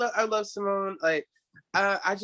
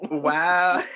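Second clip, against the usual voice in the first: ordinary delivery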